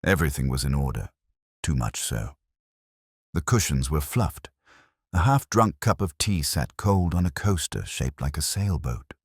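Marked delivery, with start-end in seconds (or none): none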